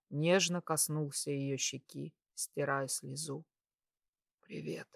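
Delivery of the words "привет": very soft, weak hello; weak, hoarse greeting. A narrator's reading. Patient answering her with little voice, weak and hoarse. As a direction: barely get the word out. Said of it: "Голос был ещё хриплым".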